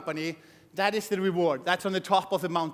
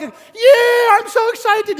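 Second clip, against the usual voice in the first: much higher and louder, gushing; overexcited beginner; 12 semitones higher, 10 dB louder, much slower than usual. Retold or imitated. New recruit after first presentation, gushing squeal. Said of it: "you go like".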